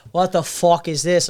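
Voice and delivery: Jerry. Retold or imitated. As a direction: grumble in a Boston accent. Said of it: "he was not liking that".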